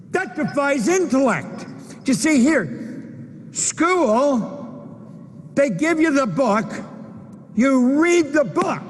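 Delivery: high-pitched, fervent motivational oratory; impassioned preaching. Acted. Speaker telling audience what learning really is, impassioned preaching. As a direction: preach it high and urgent, driving each point home.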